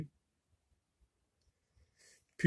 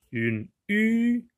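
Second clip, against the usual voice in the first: UNE U with a long, high U; son allongé; 9 semitones higher than usual. Shown as a demonstration. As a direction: hold the final U out on a high note. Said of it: "commence par U". (« une u » avec le u très allongé et aigu)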